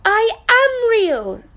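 very high, slow pleading protest; little rabbit protesting. Acted. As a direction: beg them to believe you. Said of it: "said the little rabbit".

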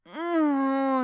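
loud, high moan of pleasure. A narrator's reading. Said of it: "I moaned in pleasure".

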